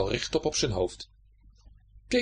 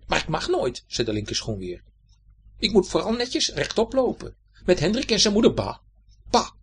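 higher, complaining little shoe voice; left shoe's voice; 7 semitones higher, 3 dB louder than usual. Acted. The left shoe moans to the right shoe, high and sulky. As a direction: sulk like a cramped little shoe.

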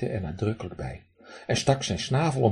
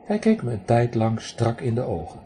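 ordinary delivery

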